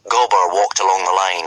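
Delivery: ordinary delivery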